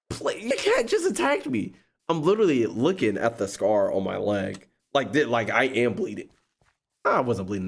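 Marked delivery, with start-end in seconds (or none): 0.1-1.7 much higher, incredulous; exasperated plea. Retold or imitated. He tells her the cat attacked him, high and incredulous. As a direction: raise it high in disbelief.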